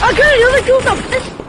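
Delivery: high excited brag; thrilled, fast pistol boast. Real, not acted. Excited about pistol kill.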